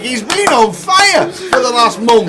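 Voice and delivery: very high, loud cry of disbelief; excited outburst. Real, not acted. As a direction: shout the winning card in wild excitement. Commentating on the winning river card.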